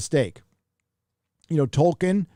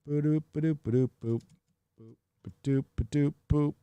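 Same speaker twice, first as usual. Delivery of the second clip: soft little nonsense boops to oneself; idle ba-doop noises; 7 dB quieter than usual. Real, not acted. Streamer killing time while clicking around, idle boops. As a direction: fill the wait with quiet mouth boops.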